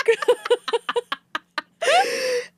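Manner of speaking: a high, bright burst of laughter; loud laugh. Real, not acted. Guest laughing at her own worship music joke, laughing out loud.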